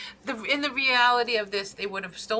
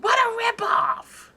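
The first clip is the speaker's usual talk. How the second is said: retold shout; 6 dB louder, slower than usual. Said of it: "I yelled as loud as I can".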